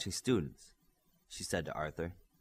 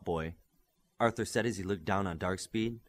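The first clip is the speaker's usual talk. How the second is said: plain reading voice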